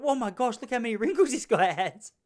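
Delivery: high, gossipy schoolgirl impression; imitated schoolgirl. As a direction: exclaim like an appalled schoolkid. Imitating overheard schoolgirl mocking him.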